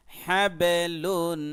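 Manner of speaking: হ্যাবেলুন drawn out very slowly and loud, the ব bounced; হ্যাবেলুন recited slowly and loudly with a jolt on ব; qalqala demo. Shown as a demonstration. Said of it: "এরকম".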